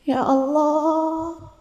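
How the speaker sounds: humble prayer. Retold or imitated. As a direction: beg God for forgiveness in a hushed, tender plea. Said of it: "kemudian berkata".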